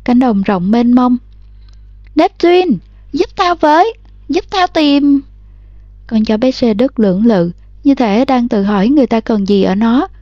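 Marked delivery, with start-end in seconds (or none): 2.1-5.2 high, carrying call to the dog; girl calling out. A narrator's reading. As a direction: call across the field for the dog. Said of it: "kêu lên". (bé gái gọi to con chó)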